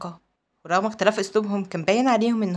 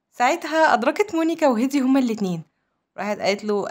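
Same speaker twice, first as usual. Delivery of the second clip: plain reading voice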